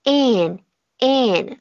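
an with a clear nasal n at the end; nasal ending demo. Shown as a demonstration. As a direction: close an on the nose. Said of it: "读完了加一个鼻音".

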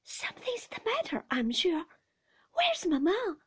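put-on high-pitched worried child; anxious child. A narrator's reading. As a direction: insist anxiously, then plead for mother. Frightened child insists, asks for mother.

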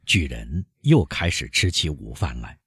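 plain reading voice

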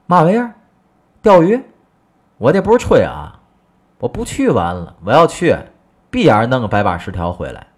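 high, loud bragging outburst; loud disbelieving braggart husband; boastful scoff. Acted. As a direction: scoff at the idea, then brag big. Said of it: "二他爸就接过来说了".

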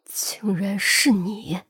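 slow, whispered, word by word fury; through gritted teeth. A narrator's reading. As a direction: spit each word out through clenched teeth. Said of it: "一字一顿从牙缝里蹦出来". (咬牙切齿地一字一顿)

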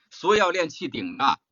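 ordinary talk, nothing marked

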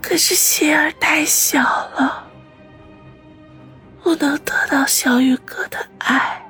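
weak, breathy whisper; barely any strength left; last breath. Acted. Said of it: "用尽最后一丝力气说".

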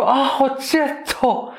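high, slow dopey prince voice; a hammed-up dim prince, high and drawn out; mock prince. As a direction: play the prince as a clueless fool, stretched out. Prince mishears name, mock voice.

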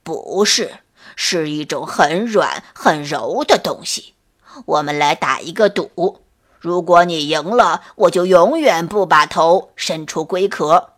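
put-on low, calm old turtle voice. A narrator's reading. Old turtle calmly challenging the scorpion to a bet. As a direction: lay out the bet steadily. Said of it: "乌龟说".

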